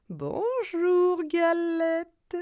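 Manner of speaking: high, sweet sly fox. Acted. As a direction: flatter her as the cunning fox. Sly fox greets galette.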